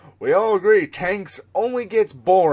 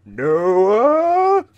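louder, higher calling for Noah; 4 semitones higher, 4 dB louder than usual. Acted. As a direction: call out across a distance. Calling out a reference line.

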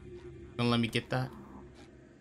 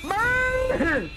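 battle yell; 14 semitones higher, much slower than usual. Real, not acted. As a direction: yell it like a war cry. Flaming the evil Squidwards.

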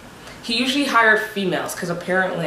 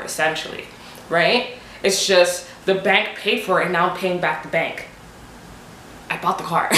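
ordinary talk, nothing marked

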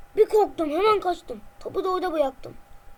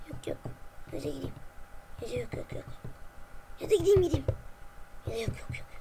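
nervous mutter; 3 semitones lower, 7 dB quieter, much faster than usual. Acted. Hesitating, afraid to go.

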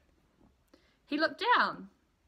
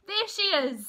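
much higher and louder, thrilled; excited spotting; 11 semitones higher, 5 dB louder than usual. Acted. Baby bird spots the boat, thrilled and high. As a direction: point and cry out in delight.

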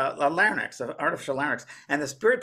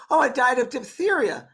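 high imitated child voice; little girl; 10 semitones higher, 5 dB louder than usual. Little girl answering the lady in the next bed, high child imitation. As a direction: play a small girl, louder and high. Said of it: "a little girl about three or four".

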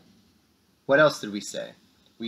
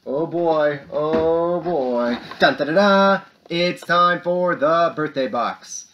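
loud, higher-pitched showy build-up; excited fanfare; 4 semitones higher, 6 dB louder, slower than usual. Pretend. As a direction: herald the segment like a game show host. Fanfare introducing birthday segment.

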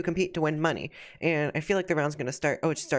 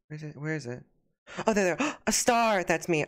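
ordinary delivery

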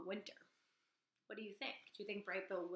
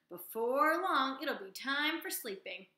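loud, slow, higher frog voice; croaking frog; 5 semitones higher, 8 dB louder, slower than usual. Acted. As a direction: stretch the words like a lazy frog. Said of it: "croaked one frog".

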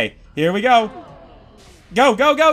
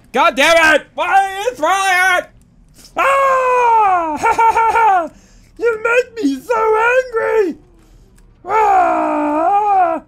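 mock rage; 10 semitones higher, slower than usual. Real, not acted. Gamer raging as the helpers throw him. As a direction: scream it in hammed-up fury.

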